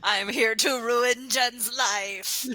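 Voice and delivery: high, slow, gleeful declaration; mock-villainous laughing boast. Real, not acted. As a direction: announce it through giggles. Player declares playfully while laughing.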